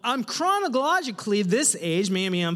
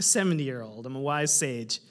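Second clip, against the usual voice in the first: a hint of a low, elderly sage voice; mock wise sage; 8 semitones lower than usual. Pastor joking about his wise elder mode, lightly mock sage. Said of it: "when I'm in pastor mode".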